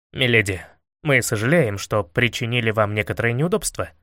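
low, courtly politeness; gallant apology. A narrator's reading. Алёша lifting the beaten captive up, low gallant courtesy. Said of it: "галантно приподнял Алёну с земли и сказал".

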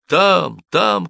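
two high urgent cries; shouting. A narrator's reading. Cobbler pointing soldiers to the hatch, shouting. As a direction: cry out high, urging them on. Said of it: "кричал Симон".

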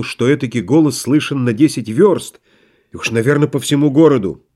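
plain reading voice

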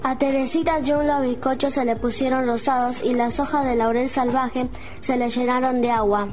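normal talking voice

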